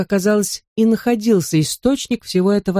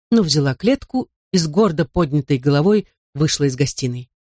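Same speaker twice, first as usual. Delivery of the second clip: plain reading voice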